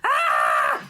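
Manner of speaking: president's scream. Acted. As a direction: shriek as a panicking president. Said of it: "the president is screaming".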